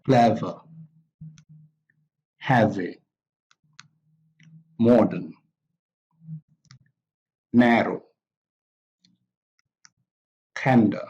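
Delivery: CLE-ver, HEA-vy, MO-dern, NAR-row, TEN-der, each stressed at the front; first-syllable stress. Shown as a demonstration. Teacher models simple adjectives, first-syllable stress on clever, heavy, modern, narrow, tender. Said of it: "So the stress is on cle".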